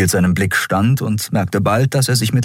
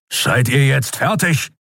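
slow, gruff, higher-pitched demand; snorting impatience; 7 semitones higher than usual. Acted. Keru interrupts their apologies, snorting with impatience. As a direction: huff it out, fed up. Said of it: "schnaubte Keru".

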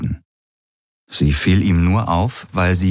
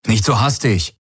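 mild higher call; calling after someone; 4 semitones higher than usual. A narrator's reading. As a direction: call a restrained warning after him. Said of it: "rief Rick ihm nach". (leicht gehobenes Hinterherrufen)

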